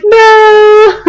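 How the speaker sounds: high no, half laughing, half crying. Retold or imitated. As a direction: squeak it out between laughter and tears. Admitting near-failure to friend.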